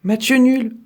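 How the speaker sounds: high excited shout, no longer able to swing; Pépin calls it a draw, cries out. Acted. Pépin shouts at Trognon, high and excited. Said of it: "crie Pépin à Trognon".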